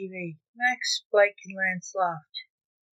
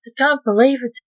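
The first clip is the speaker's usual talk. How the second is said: louder, raised-pitch astonishment; disbelief; 5 semitones higher, 10 dB louder, faster than usual. Acted. Max seeing aliens on TV, astonished disbelief. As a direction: exclaim it, higher and louder.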